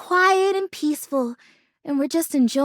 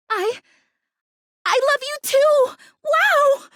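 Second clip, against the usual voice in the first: overjoyed; 9 semitones higher than usual. Acted. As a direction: let the joy burst out after the hesitation. Friend says they love her.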